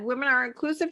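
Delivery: ordinary delivery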